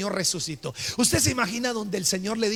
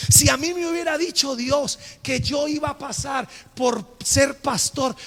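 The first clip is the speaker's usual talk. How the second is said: usual speaking voice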